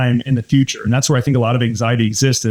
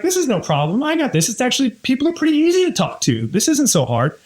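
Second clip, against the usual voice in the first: mock carefree voice, pitched much higher; breezy new confidence; 10 semitones higher than usual. Coaching a nervous colleague, imitating his breezy much higher confident voice. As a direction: toss it off like it's suddenly easy.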